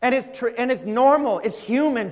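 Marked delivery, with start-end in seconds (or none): none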